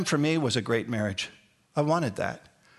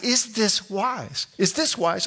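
"Is this wise" high and drawn out, then repeated quicker; exaggerated questioning inflection; 7 semitones higher, slower than usual. Shown as a demonstration. Pastor telling graduates what to ask, high pointed inflection on "Is this wise". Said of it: "with the same inflection that I'm using".

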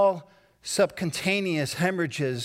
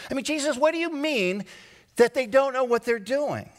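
much higher, fast, incredulous cry to Jesus; higher, exasperated questioning; exasperated plea; 7 semitones higher than usual. Pretend. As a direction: plead in disbelief. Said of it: "I stop and I go".

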